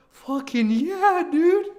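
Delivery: high, loud, drawn-out cheer; excited shout. Real, not acted. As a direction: let it burst out, stretched and high. Hype reaction to big milestone.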